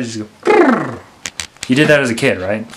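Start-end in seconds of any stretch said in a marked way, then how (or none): 0.4-1.0 loud buzzing lip trill brrr. Shown as a demonstration. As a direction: blow a lip trill like a kid. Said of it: "kinda make the brrr sound".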